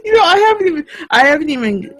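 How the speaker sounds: high, laughing, half-crying words; giggling helplessly while talking; laughing through words. Real, not acted. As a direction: squeak it out mid-laugh.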